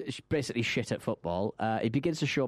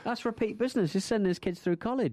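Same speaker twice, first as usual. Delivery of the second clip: higher, pleased tradesman voice; mock tradesman; 8 semitones higher than usual. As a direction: play the cheeky repairman. Riffing as window fixer.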